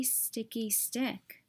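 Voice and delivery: slow, soft mope; fading sigh. Acted. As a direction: let it die away sadly. Sad refrain trailing.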